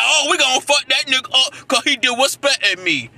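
heated mocking quote; mocking boasts. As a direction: parody their tough threats, loudly. Mimics boastful women while ranting.

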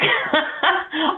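high, breathy burst of giggling; a giggle slipping out despite trying; suppressed giggle. Real, not acted. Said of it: "I'm not trying to giggle".

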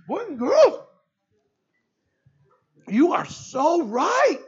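high, loud, slow and moved; emotional echo. Retold or imitated. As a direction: repeat the kids' lesson with wonder. Pastor echoing the kids, moved.

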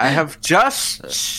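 put-on Indian accent. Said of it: "the Osho accent for him".

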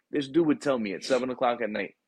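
ordinary delivery

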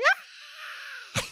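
high shrill mock scream of a kid. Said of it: "she was like".

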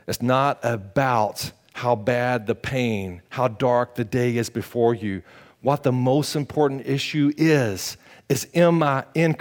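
ordinary talk

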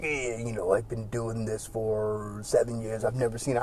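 lightly voiced soft-spoken English accent; soft-spoken William Regal. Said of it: "he's really soft-spoken".

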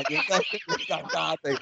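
normal talking voice

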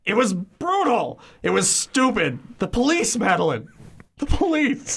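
high, frantic husband voice; panicked Ernest. Acted. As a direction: squeal it out in horror. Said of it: "I'm gonna have you read for Ernest".

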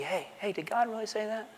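hushed, insinuating tempter; sneaky serpent. Acted. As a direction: slither up and plant a doubt very softly. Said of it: "Very sneaky".

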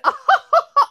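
high loud mock guffaw; loud imitated guffaw; imitating guffaw. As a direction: copy the big laugh. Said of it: "she guffawed".

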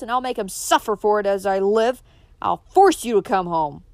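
loud furious father; father storms at his defiant daughter; stormed. Acted. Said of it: "stormed Adam".

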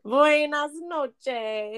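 very slow, stretched Buenas noches; drawn-out Spanish goodnight. Pretend. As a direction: stretch every syllable lazily. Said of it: "signs off in Spanish".

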